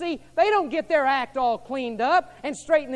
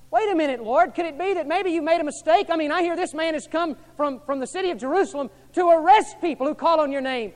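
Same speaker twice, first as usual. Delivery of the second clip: higher, louder pleading objection; anxious protest; 6 semitones higher, 8 dB louder than usual. Acted. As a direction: protest to God in alarm. Ananias objecting to God.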